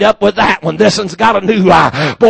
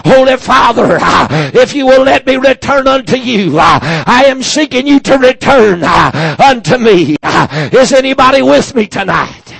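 prayer; 5 semitones higher, 4 dB louder than usual. Real, not acted. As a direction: pray it out, drawn and pleading. Prayer rising to the congregation.

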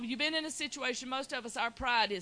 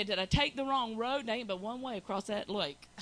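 usual speaking voice

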